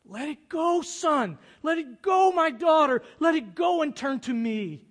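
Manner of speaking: loud, high pleading call; a father calling his child back; God calling. Pretend. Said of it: "calling to us".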